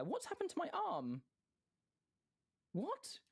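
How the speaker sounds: high-pitched, incredulous questioning; baffled surprise. Real, not acted. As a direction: shoot the pitch right up in disbelief. Baffled by character's arm in game.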